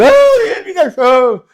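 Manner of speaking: high, slow, hammed-up lament; mock whining. Preacher mocks a woman crying over her ex-husband, high hammed-up whine. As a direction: wail it like a jilted wife, mocking.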